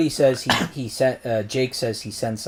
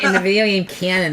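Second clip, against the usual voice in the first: higher, louder nerd voice with a laugh; nerdy voice; 6 semitones higher, 5 dB louder than usual. Pretend. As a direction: put on a nerdy lecturing tone. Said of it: "say that in a nerdier voice".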